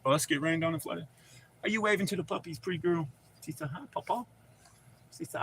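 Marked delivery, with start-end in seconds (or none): 1.6-3.1 softly doting, pitched up; sweet grandpa talk. Real, not acted. Talking sweetly to little granddaughter. 3.7-4.2 put-on tiny, high, soft child voice; soft, high-pitched mock kid voice; imitating little granddaughter. Voicing his little granddaughter.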